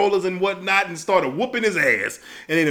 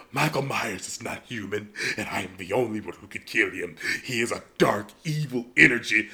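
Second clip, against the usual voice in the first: much lower and softer, grave movie-heroine voice; mock ominous heroine; 11 semitones lower, 10 dB quieter than usual. Jamie Lee Curtis warning the man in the hospital, hushed and grave. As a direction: intone it like a doom-laden prophecy, low and hushed. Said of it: "She said".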